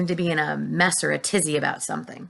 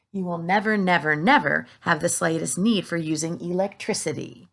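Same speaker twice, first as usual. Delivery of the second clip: ordinary delivery, nothing marked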